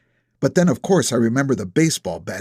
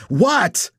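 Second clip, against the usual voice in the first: barked loud and high into the phone; shout; 11 semitones higher, 3 dB louder than usual. A narrator's reading. Nap shouts into the phone at Augie, loud and high. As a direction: snap at the caller. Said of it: "I shout into the phone".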